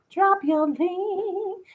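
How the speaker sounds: high, slow, drawn-out Southern belle. Acted. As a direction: stretch it out sweetly like a genteel Southern lady.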